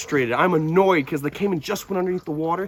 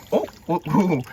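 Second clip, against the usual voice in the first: ordinary delivery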